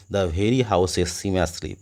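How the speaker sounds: slow, measured reading of the verse; poem recitation. Shown as a demonstration. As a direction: read the line out slowly like a poem. Reading poem line aloud.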